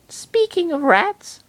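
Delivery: put-on very high, slow girly voice; teasing girl. Girl teasing the others with a rat, high and slow. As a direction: draw it out sweetly, teasing.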